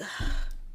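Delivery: heavy, put-upon sigh; reluctant sigh. Acted. Singer facing practice she dislikes, reluctant sigh. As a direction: sigh as if dreading the chore. Acting out her dread of singing.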